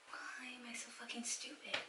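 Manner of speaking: near-whispered, defeated; hushed self-reproach. Real, not acted. Scolding herself over the haircut, whispered self-reproach. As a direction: whisper it to yourself, drained of voice.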